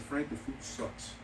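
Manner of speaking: low, hushed aside, slowly delivered; retold whisper. Diner confiding to the restaurant owner, retold whisper. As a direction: keep it discreet and soft, not truly whispered. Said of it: "I had to whisper to him".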